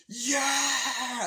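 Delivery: long, high, loud cheer; drawn-out triumphant yell; mock crowd cheer. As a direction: imitate a whole crowd cheering. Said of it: "they're all cheering".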